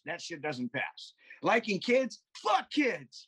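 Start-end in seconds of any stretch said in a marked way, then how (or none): 1.4-3.3 higher, building rant; worked-up rant. Real, not acted. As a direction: rise up as the rant peaks. Ranting about kids.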